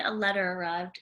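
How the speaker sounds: ordinary delivery, nothing marked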